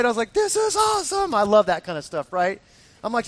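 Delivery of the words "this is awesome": high-pitched giddy excitement; thrilled. Retold or imitated. Hiker to friends after dodging a boulder, thrilled. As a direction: gush with delight, pitch way up.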